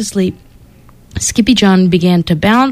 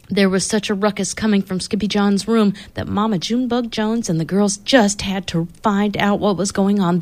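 ordinary delivery

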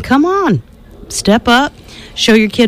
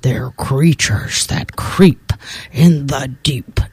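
lower, slow, fading to a whisper; creepy warning; 3 semitones lower, slower than usual. Acted. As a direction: warn spookily and sink into a whisper. Swamp creature warns baby.

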